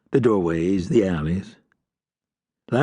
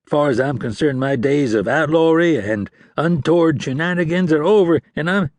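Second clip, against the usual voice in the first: higher, eager sweet-talking outlaw; pleading outlaw; 6 semitones higher than usual. A narrator's reading. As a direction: play the reformed man a bit too hard. Lang pleading innocence to ranger.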